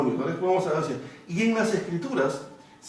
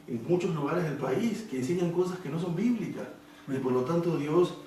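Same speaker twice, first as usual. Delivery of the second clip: ordinary talk, nothing marked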